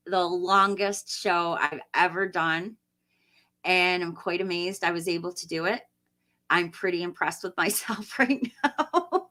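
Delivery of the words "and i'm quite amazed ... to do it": ordinary delivery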